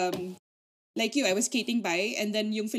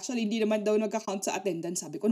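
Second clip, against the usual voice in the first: ordinary talk, nothing marked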